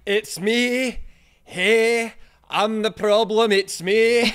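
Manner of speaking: sing-speak it in a put-on Scottish accent. To friends who asked for a clip, a Scottish accent. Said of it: "I need to hear one of these".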